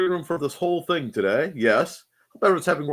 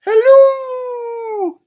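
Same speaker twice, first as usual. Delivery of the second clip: long high loud drawn hello; attempted Lurch voice; 10 dB louder than usual. Greeting chat in a put-on voice, loud. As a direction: stretch the hello out big. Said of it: "I can't do it".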